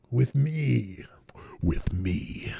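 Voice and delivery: eerie unvoiced whisper; low creepy whispered invitation; spooky devil whisper. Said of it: "mock Satanist voice".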